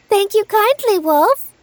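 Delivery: put-on high, sweet little girl; bright young girl promising. Girl answers the wolf.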